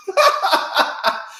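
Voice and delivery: unvoiced high laugh; airy real laugh. Real, not acted. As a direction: laugh at her own joke. Laughs about cold heart.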